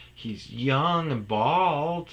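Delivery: a mom drawing out each word; slow mom voice. Retold or imitated. Mom describing son slowly.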